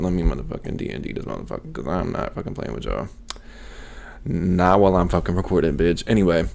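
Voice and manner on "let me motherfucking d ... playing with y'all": fast, much softer under-the-breath aside; muttered aside. Real, not acted. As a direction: drop the voice and rush it out. Aside cutting off a noise.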